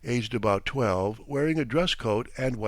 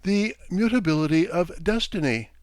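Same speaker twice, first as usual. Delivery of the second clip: high, mildly shrill sing-song voice; raised pitch with a chanting tune; 6 semitones higher than usual. A narrator's reading. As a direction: lilt it like a recited stock phrase. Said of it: "said in a shrill voice, with a sing-song intonation".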